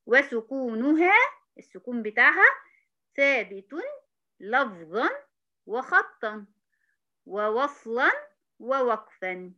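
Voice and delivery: each word stretched with pauses and lifted ends; slow dictation. Shown as a demonstration. Teacher dictating the definition slowly with pauses. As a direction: spell out the list word by word. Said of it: "dictating definition again".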